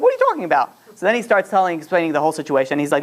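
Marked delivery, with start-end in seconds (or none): 0.0-0.7 very high, exasperated retort; mock fighting match. Wife answers husband in their fight, mock high-pitched exasperation. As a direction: imitate an incredulous wife, pitch leaping high. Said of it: "yeah, fighting match".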